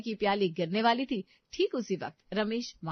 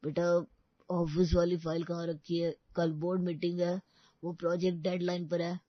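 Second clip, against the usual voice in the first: sleep-talking; 3 semitones lower than usual. Acted. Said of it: "सोते में ही बोलने लगे".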